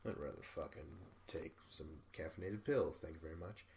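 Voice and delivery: a half-hearted mock of a dieter dodging exercise; lazy snark. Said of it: "you'd rather just, like".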